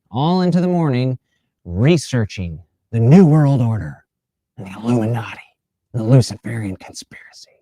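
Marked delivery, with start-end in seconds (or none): 3.0-7.5 hushed mock-spooky whisper; ominous whisper. To listeners about conspiracy theorists, a spooky whisper. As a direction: breathe it out like a secret.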